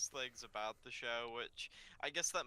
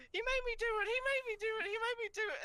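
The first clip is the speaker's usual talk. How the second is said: much higher, squeaky pleading; panicked kids; 5 dB louder, much faster than usual. Retold or imitated. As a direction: blame each other in a tiny frantic voice. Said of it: "Adam and Jake are just like".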